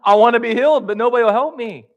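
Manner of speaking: high, loud plea; hammed-up pleading; pleading man. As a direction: beg like a man nobody helps. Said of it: "And he says".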